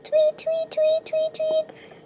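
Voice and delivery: very high chirped tweets; high piping tweet tweet; bird tweets. Pretend. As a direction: squeak it like a little bird. Imitating a bird for the butterfly.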